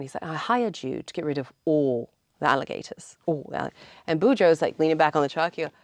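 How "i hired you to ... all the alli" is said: light retold Welsh lilt, proper and formal; a touch of a regal Welsh location manager; Welsh accent. Retelling the Welsh manager's complaint, in a mild Welsh accent. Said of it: "in his beautiful accent".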